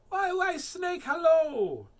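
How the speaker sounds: a bright, cheeky greeting; chirpy mouse. Acted. Mouse greeting the snake, high loud squeak.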